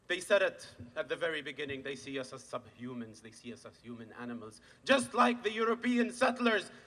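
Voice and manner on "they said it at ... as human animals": very low, hushed delivery; grave disclosure. Real, not acted. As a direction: sink the voice down, getting quieter. Reports dehumanising words.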